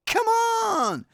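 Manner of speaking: high, drawn-out mock protest; exasperated protest. Protesting that so few people watch, exasperated. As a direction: stretch it out high in disbelief.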